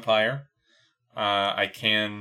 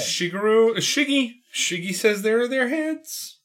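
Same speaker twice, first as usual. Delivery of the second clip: high voice; 11 semitones higher than usual. Acted. Mock Miyamoto voice.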